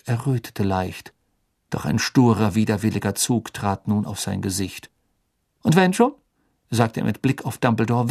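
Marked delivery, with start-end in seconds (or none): 5.6-6.1 Fudge. Acted. Fudge defensive, raised voice.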